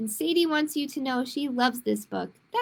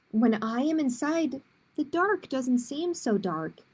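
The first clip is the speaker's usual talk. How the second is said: put-on reading to children, clear and lively; picture book read aloud; story narration. A narrator's reading. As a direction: tell it like storytime for kids. Librarian reads picture book aloud.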